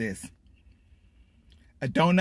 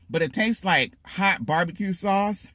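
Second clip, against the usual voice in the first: usual speaking voice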